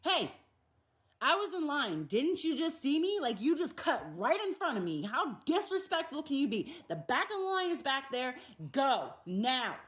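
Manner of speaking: frustrated customer snapping at a line-cutter; angry confrontation. Pretend. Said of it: "react on that frustration".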